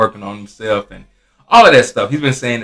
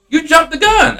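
much higher-pitched mock exasperation; exasperated retort; 3 dB louder than usual. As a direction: retell the scolding in a high, insistent voice. Retold argument with customer.

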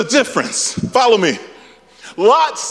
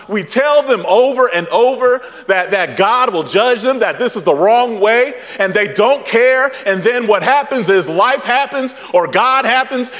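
ordinary talk, nothing marked

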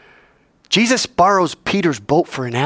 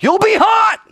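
high, loud, exasperated comeback; emphatic shout; 6 dB louder than usual. Acted. Teacher answering a what-if, high emphatic shout. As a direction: fire the answer back at full voice.

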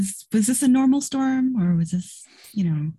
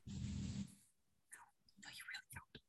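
whisper; 28 dB quieter, faster than usual. Acted. Aside to other player character.

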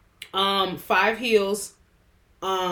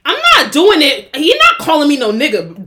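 louder, higher protest; loud refusal; 4 semitones higher, 9 dB louder than usual. Real, not acted. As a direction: refuse loudly, outraged.

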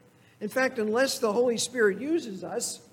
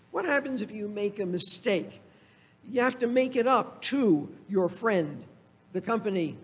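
ordinary talk

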